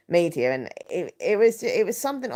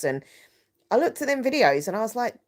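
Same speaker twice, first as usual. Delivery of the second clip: ordinary talk, nothing marked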